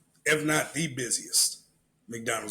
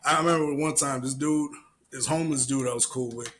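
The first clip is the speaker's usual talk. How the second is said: ordinary talk, nothing marked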